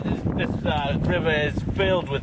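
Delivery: documentary narrator. Acted. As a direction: narrate like a bold wilderness host. Mock survival documentary narration.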